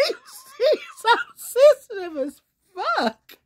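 high squealed words through laughter; cackle, then squeal it out; laughing. Real, not acted. Streamer claims she is very sensitive, laughing high.

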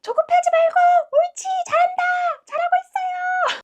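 very high, loud coaching cheers; piercing, urgent rallying calls; imitated encouragement. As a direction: shout encouragement like a remembered cheerleader. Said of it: "당신의 목소리가 떠오릅니다".

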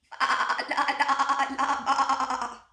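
quavering sheep fa-la-las; sung notes broken into bleats. A narrator's reading. Sheep heard from the barn.

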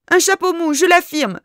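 high, loud repeated insistence; insist, raising the voice; insistent. A narrator's reading. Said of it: "répéta madame Renaud".

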